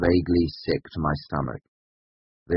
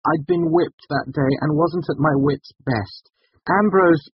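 high, louder, desperate excuse; pleading; 12 semitones higher, 7 dB louder than usual. A narrator's reading. Student excusing himself to the master, high and pleading. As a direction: plead your case until cut off. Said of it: "I pleaded".

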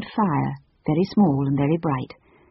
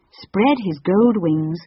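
plain reading voice

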